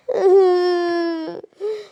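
loud, drawn-out sob; hammed-up wordless bawling; crying wail. Sister upset over ruined makeup, acted sobbing.

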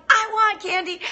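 put-on high-pitched child's demand; demanding kid. Kid demanding sweets from mom, high and insistent. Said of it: "Kids are like".

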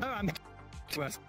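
normal talking voice

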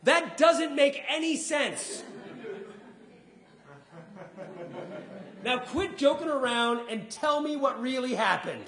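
put-on high, loud, slow whiny kid with a laugh; angry daughter. Daughter angry at her dad in the car, high and whiny. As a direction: stretch it out like a fed-up little girl. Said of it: "my daughter gets angry at me".

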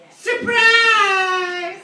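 high loud sarcastic surprise; mock surprise shout. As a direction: shout it like a party reveal. Retold wedding-crasher arrival.

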